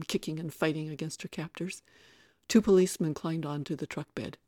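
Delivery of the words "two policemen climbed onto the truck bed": plain reading voice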